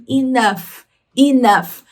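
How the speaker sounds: "enough" ending in a clear F sound. Shown as a demonstration. Teacher showing the peculiar spelling, "enough" pronounced with final F. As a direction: model "enough" as i-NUF, the GH heard as F. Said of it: "tem um som de F".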